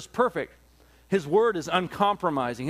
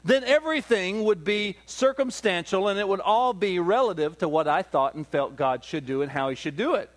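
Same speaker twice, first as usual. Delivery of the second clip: normal talking voice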